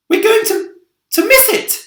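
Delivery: very high, loud, breathless cry; frantic shout. A narrator's reading. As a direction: yell it in alarm. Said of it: "shouted the ladybird".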